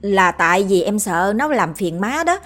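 ordinary delivery

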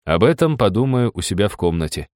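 ordinary talk

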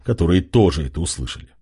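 ordinary delivery

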